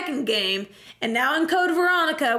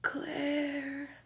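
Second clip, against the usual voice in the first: very soft, drawn-out name; mock dying voice; 11 dB quieter than usual. Dying Steve calling to Claire, faint mock last breath. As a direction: let the name out weakly.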